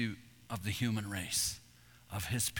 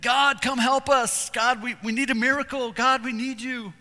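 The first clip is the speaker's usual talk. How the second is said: desperate plea; 9 semitones higher, 7 dB louder than usual. Retold or imitated. Parent praying after a child's fall, desperate loud plea. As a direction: cry out to God in crisis.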